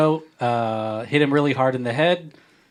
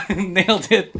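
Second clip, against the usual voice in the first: laughing; 6 semitones higher, 4 dB louder, slower than usual. Real, not acted. Repeats cheer laughing.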